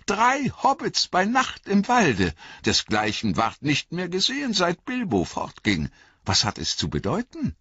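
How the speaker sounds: wondering elves. A narrator's reading. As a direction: wonder aloud in a ringing voice. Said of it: "sagten sie".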